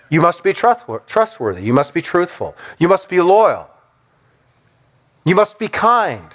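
ordinary delivery

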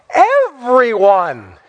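usual speaking voice

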